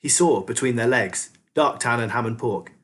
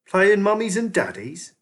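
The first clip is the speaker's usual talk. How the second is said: cheerfully; 9 semitones higher than usual. A narrator's reading. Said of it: "said Ratcatcher two cheerfully".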